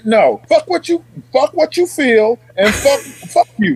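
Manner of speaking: high, emphatic cussing-out; acted angry dismissal. Sergeant refusing to coddle soldiers, heated dismissal. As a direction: cut them off and punch each curse, pitch up.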